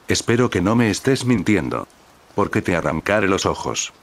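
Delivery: ordinary delivery, nothing marked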